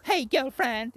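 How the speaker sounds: high, put-on sassy greeting; mock sassy voice. Mimicking Bianca Belair's persona, high mock sassy greeting. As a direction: imitate a sassy catchphrase.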